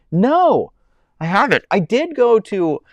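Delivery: high, loud, eager no; answering a chat question about a burger place, excited. Real, not acted. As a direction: burst out with the answer.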